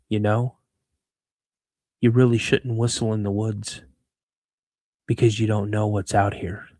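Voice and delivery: lightly voiced stranger, low and measured; ominous stranger's warning. Stranger warns a man whistling in the woods, ominous low warning. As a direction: retell the warning low, with pauses.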